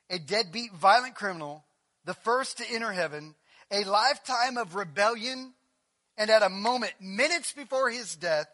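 loud, driving sermon cadence; preaching at full force. Acted. Pastor stirring the congregation, full force preaching. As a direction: hammer each phrase to the congregation.

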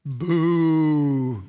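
long, drawn-out boo, falling away; crowd-style boo. Shown as a demonstration. Preacher leading everybody in booing the Babylonians, jeering. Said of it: "everybody say, "Boo".

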